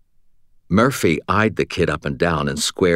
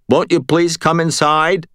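high, raised call to those above; calling up the stairs; 10 semitones higher, 4 dB louder than usual. A narrator's reading. Josh to those at the top of the stairs, calling out. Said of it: "up toward the top of the stairs".

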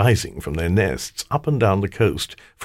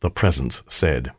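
usual speaking voice

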